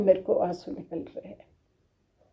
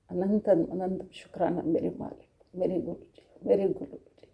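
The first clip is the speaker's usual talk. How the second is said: much softer, devoted murmur of thanks; hushed prayer; 7 dB quieter than usual. Real, not acted. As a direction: pray under your breath to the guru. गुरु को धन्यवाद की प्रार्थना. (धीमी आवाज़ में प्रार्थना)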